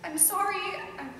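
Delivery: very soft, halting apology; holding back tears. Acted. Wife apologizing after the quarrel, holding back tears. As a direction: falter quietly, fighting the urge to cry. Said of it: "I don't want to cry".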